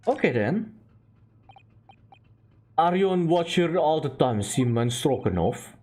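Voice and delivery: ordinary delivery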